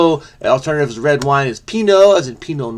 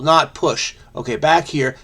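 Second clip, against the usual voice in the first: usual speaking voice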